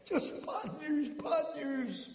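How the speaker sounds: high, slow woeful moan; hammed-up lament of the arrested man; mock despair. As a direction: wail it like a man whose life is ruined. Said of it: "He says".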